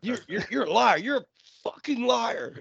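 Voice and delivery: high, strained accusation, stumbling into the swear; mock-choked, stammering outrage; struggling to curse. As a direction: fight to force the curse out, slowing down. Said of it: "He really fought to get that fuck out".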